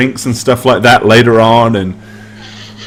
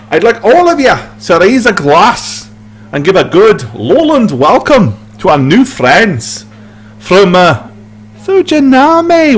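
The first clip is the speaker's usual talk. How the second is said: sarcastic grin; 6 semitones higher than usual. Acted. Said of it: "with a really sarcastic grin".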